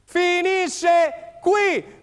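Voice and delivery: very slow stretched cry; final whistle shout. Real, not acted. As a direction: drag out each word triumphantly.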